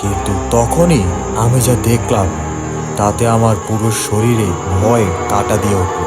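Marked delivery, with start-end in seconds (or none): none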